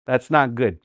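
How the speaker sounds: ordinary delivery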